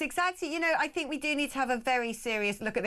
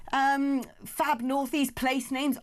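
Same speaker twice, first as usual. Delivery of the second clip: normal talking voice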